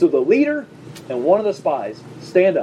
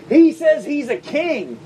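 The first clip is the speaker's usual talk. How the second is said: accusing priests; 8 semitones higher, 3 dB louder, slower than usual. Acted. Chief priests accuse Jesus to Pilate.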